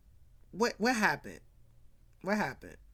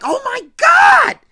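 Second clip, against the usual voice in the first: shrieking in horror, voice cracking; horrified high scream; 17 dB louder than usual. Retold or imitated. Sister finding her brother's victim, screaming in horror. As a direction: scream it high and loud, crying out in shock. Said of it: "she's like".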